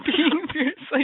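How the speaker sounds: words lost in a laugh; giggling through the words. Real, not acted. Laughing hard mid-show.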